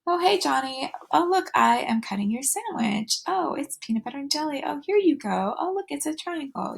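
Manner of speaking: high, sing-song parent voice narrating own actions; chirpy motherese, describing what you're doing to a little child; bright high-pitched talk to a toddler; self-talk to a toddler. Shown as a demonstration.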